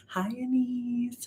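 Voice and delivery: soft breathy whisper, slow; whispered hello. Real, not acted. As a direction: whisper the greeting gently. Greeting a viewer by name.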